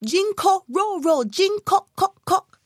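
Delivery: high chanted toad croak; little toad calling back. A narrator's reading. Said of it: "the second child called out".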